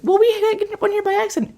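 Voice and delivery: put-on very high timid kid voice; high-pitched sheepish kid; squeaky kid. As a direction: mumble it like a caught little kid.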